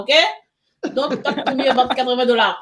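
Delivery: ordinary talk, nothing marked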